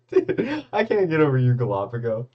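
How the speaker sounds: high-pitched, laughing through the words; real laughing. Real, not acted. Streamer cracking up at a chat comment, laughing as he talks. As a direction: crack up while reading it out.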